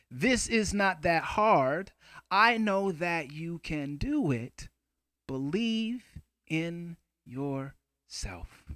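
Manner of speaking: slow, spaced-out haiku recital; deliberate verse, pausing between last words; poem recitation. Shown as a demonstration. The two poems that you just heard me do.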